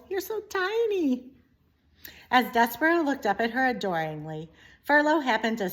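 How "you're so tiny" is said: princess. Acted. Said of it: "said the princess to Despero".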